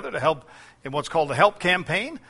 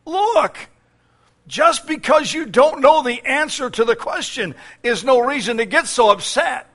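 much higher, shaken, face-saving excuse; battered lion; 7 semitones higher, 5 dB louder than usual. Acted. Beaten lion excusing himself to the elephant, high and shaken. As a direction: squeak it out, trying to save face. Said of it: "staggers shakily to his feet and is heard to say".

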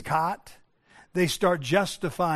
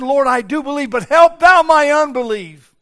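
loud, high imploring cry; pleading cry; 7 semitones higher, 10 dB louder than usual. Retold or imitated. Preacher voices broken father crying to Jesus, loud pleading cry. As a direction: cry out to the Lord at full force.

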